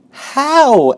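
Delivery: high, loud eager question. Acted. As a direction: blurt it out. Wilbur eager.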